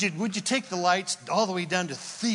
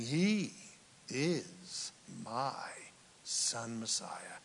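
hushed, low and much softer; voice from heaven; 7 semitones lower, 10 dB quieter, much slower than usual. Acted. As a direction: let it sink to a reverent hush. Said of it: "a voice from heaven saying".

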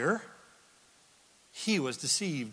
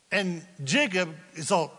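usual speaking voice

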